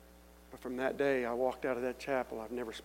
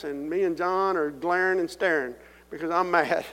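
loud, higher storytelling push; full-force preaching; 4 semitones higher, 10 dB louder than usual. Acted. To the congregation, loud preaching. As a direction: preach it out big.

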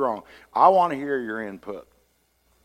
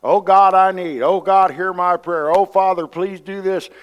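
pleading prayer; 5 semitones higher, 8 dB louder than usual. Retold or imitated. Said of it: "I catch myself a lot of times".

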